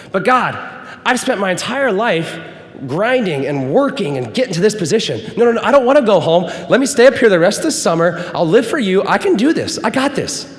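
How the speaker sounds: high, loud, fast insistence; frantic bargaining with God; pleading protest. Retold or imitated. Arguing back with God.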